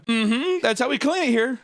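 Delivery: high, sing-out chirped reply; put-on perky coworker imitation; mock eager employee. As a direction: answer high as the over-keen trainer. Imitates Peg's eager answer.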